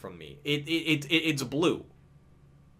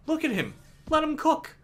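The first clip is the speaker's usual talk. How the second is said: high cheering voice; 13 semitones higher than usual. Pretend. Cheering on his character.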